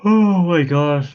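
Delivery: slow, high, drawn out through a yawn; real tired yawn mid-sentence. Real, not acted. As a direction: let a yawn pull the words long. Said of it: "Please stop yawning".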